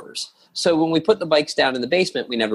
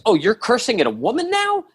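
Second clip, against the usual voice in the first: indignant super; 9 semitones higher than usual. Retold or imitated. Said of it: "And she goes".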